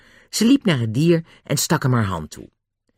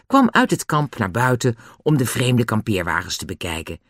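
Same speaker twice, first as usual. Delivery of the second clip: ordinary delivery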